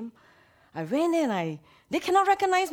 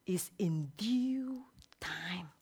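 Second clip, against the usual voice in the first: slow whisper; 5 dB quieter, much slower than usual. Real, not acted. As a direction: whisper it, drawn out slowly. Scripture phrase whispered slowly.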